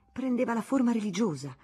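plain reading voice

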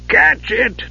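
high, urgent command; commanding shout. Acted. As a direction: bark the order at her. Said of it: "Dugan commanded".

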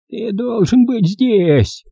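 put-on high, slow lecturing old woman's voice; preachy old crow. A narrator's reading. Old crow scolding the boy, high and preachy. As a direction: wag a finger at him as the old crow. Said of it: "назидательно проговорила мисс Кроу".

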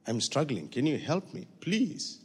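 put-on soft, childlike plea to Papa with tears; tearful plea. Preacher retelling his prayer to God, soft and tearful. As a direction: ask Papa for help like a little child, softly. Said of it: "I say".

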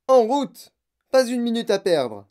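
loud, high urgent call; exhortation pressante. A narrator's reading. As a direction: hurry the group along loudly. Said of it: "dit monsieur Wonka". (presser le groupe, voix forte et aiguë)

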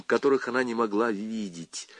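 ordinary delivery, nothing marked

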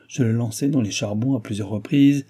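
usual speaking voice

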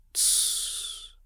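sharp hushed shh; shushing. A narrator's reading. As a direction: hiss a quick shush. Siri hushes him underwater.

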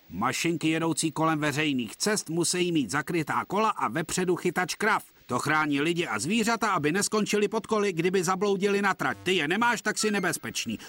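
a hint of a bossy constable reciting rules; stern policeman. Policeman lectures Thomas about rules, lightly officious.

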